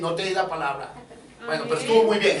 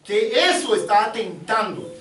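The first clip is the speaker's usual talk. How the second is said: high-pitched, forceful pulpit voice; raised, insistent preacher's voice; emphatic preaching; 7 semitones higher than usual. Real, not acted. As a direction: press the argument on the congregation.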